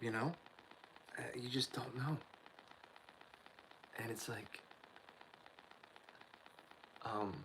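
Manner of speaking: ordinary talk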